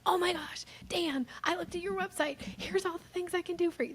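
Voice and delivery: high, gushing over-eager pitch; over-eager high sales gush; dog calling energy. Retold or imitated. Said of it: "dog calling energy".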